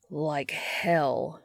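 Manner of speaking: understated snarl at an enemy; a restrained, defiant snarl; snarled defiance. A narrator's reading. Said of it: "Dean snarls".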